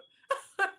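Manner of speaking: a sudden high, loud laugh; loud burst of laughter. Real, not acted. Laughing at her own joke.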